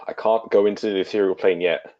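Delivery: usual speaking voice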